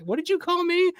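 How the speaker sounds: high-pitched mock indignation; affronted. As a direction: imitate someone taken aback by an insult. Retold affronted celebrity confronting a heckler.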